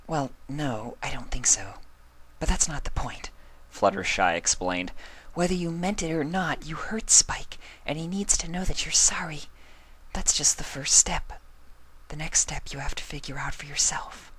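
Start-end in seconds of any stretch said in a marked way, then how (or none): 0.1-3.3 high, soft, breathy-whispered shy pony; whispering Fluttershy. Acted. Fluttershy softly. 5.3-14.3 high, soft, breathy-whispered shy pony; whispering Fluttershy. Acted. Fluttershy explaining softly.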